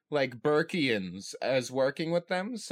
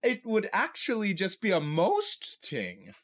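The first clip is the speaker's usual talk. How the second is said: Gobber; 5 semitones higher than usual. Acted. Podcaster voicing Gobber at the council's reunion, hammed-up character voice. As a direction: put on a higher, punchier voice for a comic suggestion. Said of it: "And then Gobber be like".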